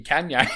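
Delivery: "[name]" with the a of "can"; Can-yay mispronunciation. Shown as a demonstration. Echoing the mock pronunciation.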